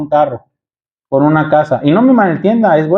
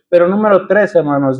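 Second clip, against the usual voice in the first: ordinary delivery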